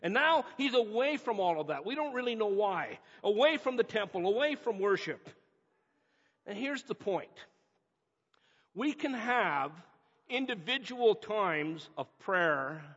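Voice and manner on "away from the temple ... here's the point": ordinary delivery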